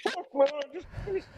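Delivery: high, pleading cry for help; desperate plea. Retold or imitated. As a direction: beg a friend for help, voice cracking high. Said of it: "I'm literally like".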